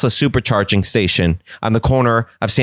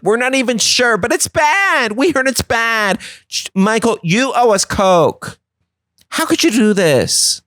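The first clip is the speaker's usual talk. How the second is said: high, shocked scolding; mock outrage demanding a Coke; 12 semitones higher than usual. Friends scolding Michael, high and indignant. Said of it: "they're like".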